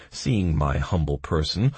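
ordinary delivery

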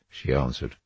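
ordinary delivery, nothing marked

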